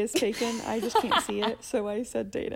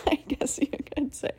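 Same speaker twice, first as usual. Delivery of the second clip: whispered aside; 4 semitones higher than usual. Real, not acted. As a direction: drop to a breathy aside.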